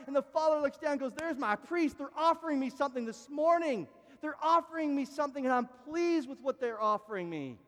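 loud, high, fervent preaching; full-force preaching. Acted. Preacher urging his congregation at full force.

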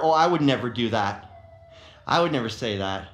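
low, smug "I would never do that"; smug superiority. Pretend. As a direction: look down your nose, low and smug. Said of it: "we act as if we are superior".

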